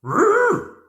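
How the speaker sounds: high, loud playful doggy growl. A narrator's reading. Hudson the dog agreeing, playful growl. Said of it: "Hudson agreed".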